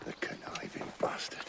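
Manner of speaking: whispered curse; seething under the breath; bitter whisper. Acted. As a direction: hiss it so no one hears. Pirate curses the officer secretly.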